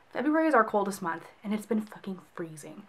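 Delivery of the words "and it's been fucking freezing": hushed whisper with a curse; a sneaky little whispered aside. Real, not acted. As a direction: whisper the swear so it stays quiet. Cursing about cold, hushed aside.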